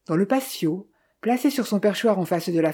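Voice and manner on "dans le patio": plain reading voice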